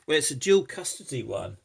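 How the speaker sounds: normal talking voice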